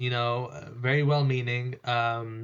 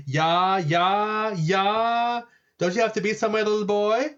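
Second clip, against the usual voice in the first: higher, drawn-out bored yeahs; sassy dismissal; 6 semitones higher than usual. Retold or imitated. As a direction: brush the boy off with sass. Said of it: "Alexandra would be like".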